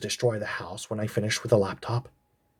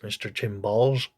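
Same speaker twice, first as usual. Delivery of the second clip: ordinary talk, nothing marked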